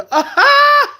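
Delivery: a sudden loud, high burst of laughter. Real, not acted. Cracking up at her own joke on stream, loud laugh burst.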